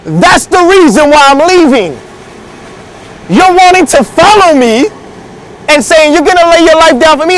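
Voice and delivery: incredulous rebuke. Acted. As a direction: take Peter to task, voice climbing. Jesus rebukes Peter, acted.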